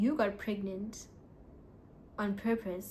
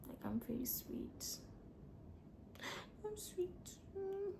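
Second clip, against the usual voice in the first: coy sweet voice; 10 semitones higher, 15 dB quieter, slower than usual. Pretend. Coy self-praise.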